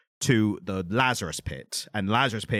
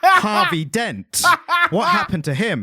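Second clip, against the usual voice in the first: high, loud, theatrical questioning; hammed-up concern; 11 semitones higher, 4 dB louder than usual. Podcaster asking after good friend Harvey Dent, hammed-up concern. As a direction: play up the dramatic question, stretching the name.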